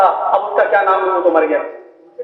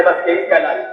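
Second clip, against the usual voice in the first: ordinary talk, nothing marked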